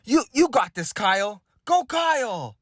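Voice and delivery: high, bright cheering from the stands; cheering parent. Retold or imitated. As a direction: cheer on someone else's kid. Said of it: "another parent goes".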